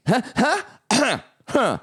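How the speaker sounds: high, halting choked "huh" noises; choking laugh imitation. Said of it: "like they were choking on it".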